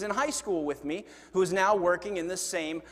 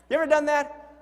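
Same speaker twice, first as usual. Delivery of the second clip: ordinary talk, nothing marked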